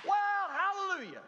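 high, loud sarcastic hallelujah; mock joyful praise. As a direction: play an over-the-top happy churchgoer. Sarcastic mock praise, much higher.

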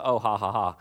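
low, half-hearted fake laugh; dry mock laughter; hollow polite laugh. Shown as a demonstration. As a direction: give a token ha-ha with no real mirth. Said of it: "that laughter's not just a".

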